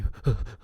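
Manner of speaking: quick puffs of mock panting; runner's panting. Storyboard artist acting out a character running, mock panting. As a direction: puff like someone running. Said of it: "runs, he's like".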